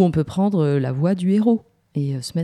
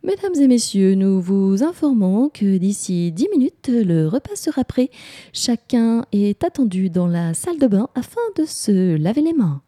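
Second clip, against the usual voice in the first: higher, drawn-out tannoy announcement; singsong store announcer, slow and raised; department store announcer; 5 semitones higher than usual. Shown as a demonstration. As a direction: announce it formally to all shoppers. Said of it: "la voix du grand magasin".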